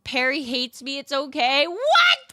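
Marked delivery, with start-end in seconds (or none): 1.7-2.3 much higher and louder what; hammed-up disbelief; mock shock. Streamer reacts to a chatter being hated, with mock shock.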